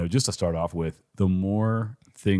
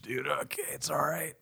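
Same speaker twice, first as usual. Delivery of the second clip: whispering; 8 semitones higher, 8 dB quieter than usual. Real, not acted. Quiet aside after donut joke.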